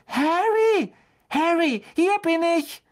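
high, loud excited call. A narrator's reading. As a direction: call to a friend across the street. Hermione calls to Harry from afar.

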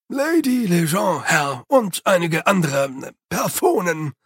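lightly voiced grumbling Igor, slowed; a sullen servant announcing the guests. A narrator's reading. Igor announcing the visitors to Jeremy, mildly grumbling. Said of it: "brummte Igor".

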